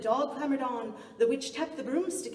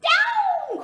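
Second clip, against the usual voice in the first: much higher and louder shout; witch's cry; 13 dB louder than usual. A narrator's reading. Witch cries out to land and chase her lost hat. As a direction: yell it down to the ground. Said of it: "cried the witch".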